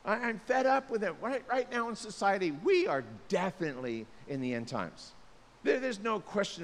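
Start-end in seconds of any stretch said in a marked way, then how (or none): 0.0-1.1 fed-up brush-off. Retold or imitated. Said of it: "do you have this thing".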